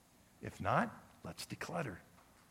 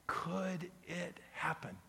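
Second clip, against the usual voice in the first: hushed question; 5 semitones higher, 6 dB quieter, slower than usual. Acted.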